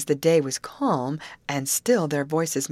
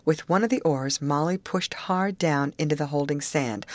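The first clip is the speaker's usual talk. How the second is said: usual speaking voice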